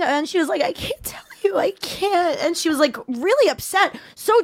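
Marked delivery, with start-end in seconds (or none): 0.6-2.4 whispered, high, teary imitation; mock tearful whisper. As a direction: breathe it out like someone crying. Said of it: "still actively crying".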